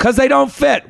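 put-on high, whiny child's protest; exasperated kid. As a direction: answer back like a fed-up kid. Child answering back in acted quarrel.

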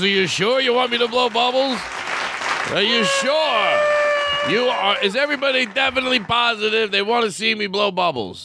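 milk the suspense like a clown; teasing clown. Pretend. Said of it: "He goes".

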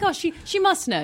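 high-pitched mock admiration; imagined impressed listener. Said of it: "you're like".

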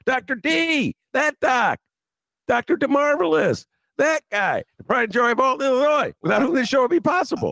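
high, excited hype-man delivery; hyped-up introduction. Pretend. Host introducing his co-host, high excited hype. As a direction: proclaim it high, with big fanfare.

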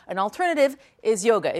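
ordinary delivery

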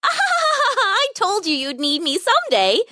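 high, squeaky giggling glee; giggle, then crow in a squeaky voice; gleeful mouse. A narrator's reading. Mouse proved right to the lion, gleeful. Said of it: "cried the mouse gleefully".

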